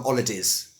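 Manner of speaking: "holidays" as "olidiz", no H; H dropped, Z ending. Shown as a demonstration. Teacher showing extreme Cockney "holidays", H dropped. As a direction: drop the H and buzz the end of "holidays". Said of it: "with a Z Z at the end".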